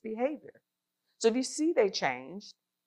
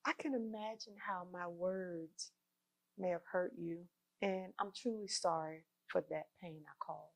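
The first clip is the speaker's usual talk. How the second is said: sincere apology; 13 dB quieter than usual. Shown as a demonstration. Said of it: "And scene".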